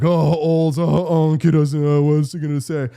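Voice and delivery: loud mock jeering voice; hammed-up loud group guffaw; mocking young men laughing. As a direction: imitate young guys hooting at an old man. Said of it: "they were all laughing at me".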